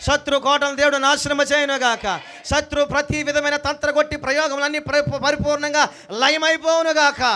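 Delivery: loud, high, shouted preaching declarations; preaching at full force. Acted. Preacher proclaiming blessings over the congregation, shouting with full force.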